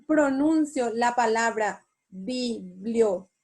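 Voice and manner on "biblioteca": "biblioteca" split slowly by syllable, TE stressed; bi-blio-TE-ca, slowly, leaning on TE. Shown as a demonstration. Teacher showing where the voice falls, "biblioteca" stressed on TE. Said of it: "¿dónde cargué la voz?".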